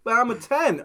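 high, bright mock shopper at the shoe rack. A customer talking to herself at the shoe rack, mock-hopeful. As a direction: play a hopeful customer spotting shoes.

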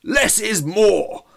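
very slow, loud, high booming cry; bellow. Acted. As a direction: bellow it to the crowd. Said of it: "Trevor bellowed".

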